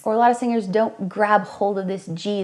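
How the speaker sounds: ordinary delivery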